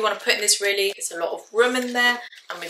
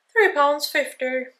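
price voice up; 7 semitones higher, slower than usual. Real, not acted. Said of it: "my voice goes up when I say the price".

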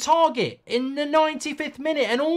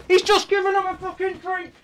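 higher disbelieving repeat; incredulous; 5 semitones higher than usual. Real, not acted. Fan repeating the complaint about Southgate, incredulous higher.

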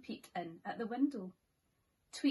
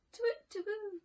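high hooting owl call; high falling owl hoots; 10 semitones higher than usual. Acted. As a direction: hoot like an owl at night. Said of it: "went the owl".

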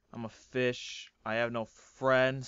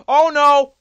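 much higher, loud startled cry; shocked yell; 8 semitones higher, 17 dB louder than usual. Real, not acted. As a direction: shout as you get sniped. Killed by another player.

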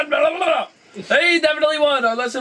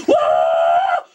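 low, loud mock whoa; 4 dB louder than usual. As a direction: boom out a silly whoa. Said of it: "And then I'm gonna go".

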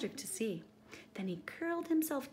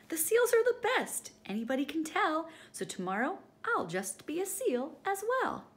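high, louder cheeky emu voice; gleeful Edward; 8 semitones higher, 5 dB louder than usual. Acted. Edward boasting about seals, high and gleeful. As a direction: pitch Edward up, pleased with himself. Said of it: "he laughed as he said".

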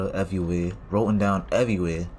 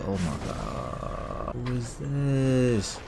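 hushed dread; 6 dB quieter, much slower than usual. Real, not acted. Creeping through the dark sewer.

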